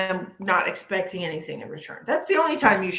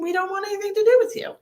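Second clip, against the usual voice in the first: dismissive; 12 semitones higher, 3 dB louder, much faster than usual. Real, not acted. Dismissive quick high line.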